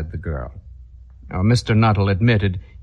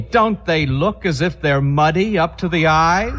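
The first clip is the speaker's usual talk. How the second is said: suddenly delighted and alert; brightened; 7 semitones higher than usual. A narrator's reading. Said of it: "suddenly brightened into alert attention".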